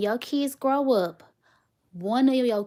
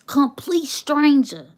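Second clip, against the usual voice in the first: louder, higher and slow with amazement; emphatic exclaim; 6 semitones higher, 7 dB louder, slower than usual. Real, not acted. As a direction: hammer home the disbelief. Emphatic repeat of the story's point.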